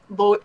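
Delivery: "bo-" with a long drawn-out O; Midwestern long O. Shown as a demonstration. Explaining her tired Midwestern accent, "bo-" with long O. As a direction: stretch the O in "bo-". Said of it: "my O's get really long".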